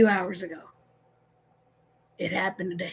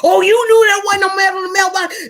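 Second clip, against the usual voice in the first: higher, louder mock outburst; upset husband; 8 semitones higher, 6 dB louder than usual. Husband shouting at wife about the mail. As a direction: play the indignant husband.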